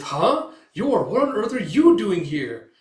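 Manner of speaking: high, loud startled voice; surprised Melinda. Acted. Manga reading, Melinda's surprise.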